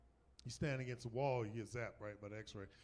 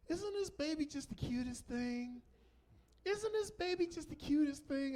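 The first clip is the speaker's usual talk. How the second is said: doting parent; 14 semitones higher than usual. Retold or imitated. Doting parent showing the nurse their baby, high gushing coo.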